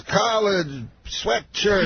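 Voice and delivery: very slow, high impersonation; Bill Cosby. Retold or imitated. Star comedian talking in just words, drawn-out imitation. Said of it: "like, just in words".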